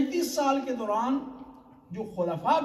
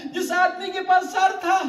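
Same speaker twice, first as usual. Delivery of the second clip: loud, high, wailing sermon climax with sobs in the voice; impassioned majlis preaching; 7 semitones higher, 8 dB louder than usual. Acted. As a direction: cry it out to the mourners, building grief. Majlis climax, preacher at full force. (پرجوش گریہ آمیز مجلس خطابت)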